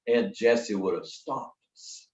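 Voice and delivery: ordinary talk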